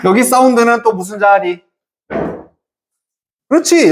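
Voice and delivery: ordinary talk, nothing marked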